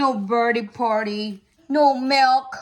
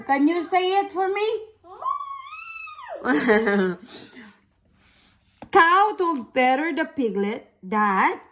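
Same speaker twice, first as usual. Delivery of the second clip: ordinary delivery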